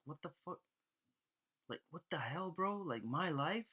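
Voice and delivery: high, hushed outrage with rising questions; mock indignation. Man insulted by God's joke about his life, indignant. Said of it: "immediately got angry".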